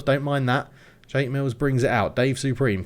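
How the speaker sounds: normal talking voice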